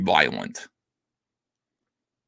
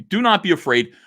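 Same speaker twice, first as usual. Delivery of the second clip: usual speaking voice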